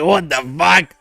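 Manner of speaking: high, stunned exclamation; shocked. Retold or imitated. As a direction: recoil in shock. Realizing it was a boy.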